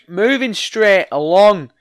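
usual speaking voice

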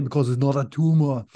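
a clumsy, half-landed Austrian action-hero voice; bad Arnold impression. Podcaster tries Arnold for cohost, weak impression. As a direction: toss off a weak Arnold Schwarzenegger. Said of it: "my worst Arnold impersonation".

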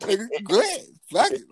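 high, laughing replay of the dare; cracking up while repeating his own dare to the dad. Retold or imitated. Telling his friend the dare, laughing high.

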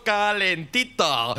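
slow teasing calentito; drawn out slowly. Acted. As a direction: stretch each syllable of calentito slowly. Repeats the word, drawn out.

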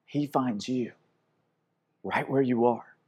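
quiet, hushed, tender invitation; soft closing appeal. Acted. Preacher gently inviting the congregation to come home, hushed.

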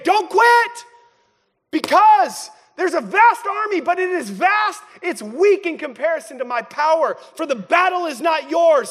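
high, forceful shout of encouragement; shouting back. Acted. God answering the one who reminds him, shouting back. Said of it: "he begins to shout back to you".

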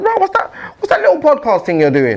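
high, laughing mockery of a rival; mocking quote. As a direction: sneer at it with a laugh. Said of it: "that was like".

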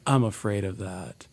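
soft, slow imitation of her opening line; mock catchphrase. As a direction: lightly mimic her dry opener, quietly. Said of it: "she always opens every podcast with".

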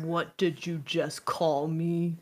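low, ominous challenge; low-voiced, threatening big guy; menacing big brawler. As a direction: drop the pitch like an offended heavy. Big person insulted in fight.

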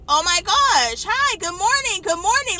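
high, gushing school-morning hellos; cheery greeting. Retold or imitated. School staff greeting an arriving student, excited and friendly. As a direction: greet someone as if delighted to see them.

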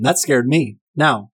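ordinary talk, nothing marked

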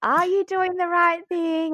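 high, anxious second-guessing voice; doubting inner voice. Retold or imitated. The doubting inner voice asking herself, high and anxious. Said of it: "that little human side of you is like".

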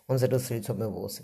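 ordinary delivery

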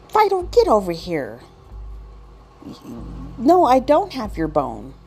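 high, insistent owner voice; scolding dog owner. Acted. Owner orders Fido over and denies the bone, high scolding.

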